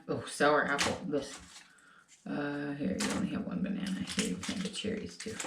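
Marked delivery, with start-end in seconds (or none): 2.3-5.3 soft unvoiced whisper, fast; whispered aside. Real, not acted. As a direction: whisper it to the person next to you. Quietly sharing candy aside.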